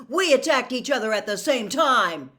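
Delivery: loud protesting shout. A narrator's reading. Harry protesting to Snape, loud shout. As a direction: shout your defence. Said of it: "Harry shouted".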